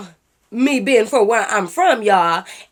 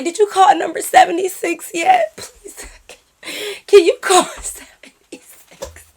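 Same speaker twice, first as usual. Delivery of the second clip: high, mock-panicked plea; hammed-up whimpering urgency; desperate pleading; 8 semitones higher, 5 dB louder than usual. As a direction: beg the server, voice pitched up. Acting out desperate plea to server.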